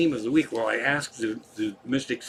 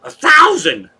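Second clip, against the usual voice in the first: sudden high loud squawk of a number; Sparky's voice; 14 semitones higher, 11 dB louder, slower than usual. Retold or imitated. Streamer doing a character voice for chat, high and loud. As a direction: shout it way up high like a cartoon character. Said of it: "in Sparky's voice".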